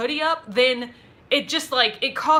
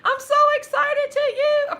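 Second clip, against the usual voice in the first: thrilled squeal; 13 semitones higher than usual. Real, not acted. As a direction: squeal with delight for her. Congratulates viewer again.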